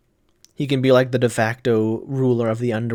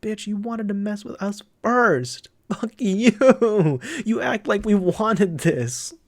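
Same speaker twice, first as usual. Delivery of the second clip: indignant yelling at the screen; 7 semitones higher, slower than usual. Real, not acted. Viewer yelling at the anime villain, indignant and raised. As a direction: shout him down like he wronged you personally.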